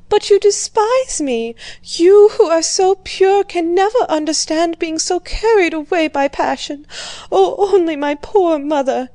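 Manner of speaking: high, loud, anguished plea; a woman who thinks her friend despises her, pleading in distress; distraught tearful outburst. A narrator's reading. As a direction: cry it out as a girl ashamed of her passion. Bourienne distraught, confessing her passion.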